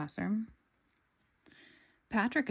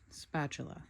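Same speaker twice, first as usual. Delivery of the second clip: low, soft dry correction; correcting Squidward; 4 semitones lower, 7 dB quieter than usual. A narrator's reading. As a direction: set him straight, bored. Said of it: "corrected Squidward".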